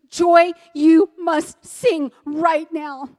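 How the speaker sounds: slow, loud, emphatic order; firm command. Retold or imitated. God telling grieving widow she must sing, firm command.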